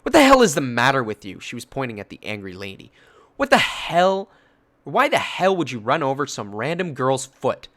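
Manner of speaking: imitated angry mother; angry defender. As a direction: tear into the bully. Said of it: "She looked angry for me".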